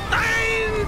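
high, loud screaming despair; anguished scream. Real, not acted. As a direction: wail at the enemy who robbed you. Loses souls in game.

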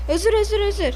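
high, loud, hurried pleading; a panicked, yelled sorry; frantic apology. Acted. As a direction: beg forgiveness fast and loud. Cat begs sorry.

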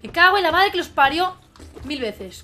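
loud, high swearing outburst; exasperated cursing. Real, not acted. Gamer swearing at the enemies, exasperated cursing. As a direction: curse the enemies at full voice.

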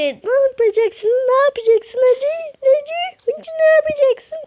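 very high squeaky mocking voice; squeaky taunting commenter. Gamer imitating commenters daring him, high squeaky taunt. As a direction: taunt in a tiny, high-pitched put-on voice. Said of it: "Onu söylersiniz böyle".

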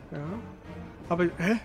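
usual speaking voice

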